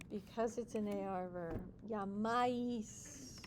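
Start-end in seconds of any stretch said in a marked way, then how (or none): 1.9-3.4 Llamáis stretched out slowly, weight on the "á"; stress on the A. Shown as a demonstration. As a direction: drag Llamáis slowly and land on the A. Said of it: "It's on the A".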